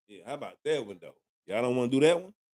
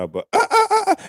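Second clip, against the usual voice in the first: high, outraged stammer; sputtering in disbelief; 5 dB louder, faster than usual. Real, not acted. To a viewer who won't buy a drink, sputtering high. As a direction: choke on the words in shock.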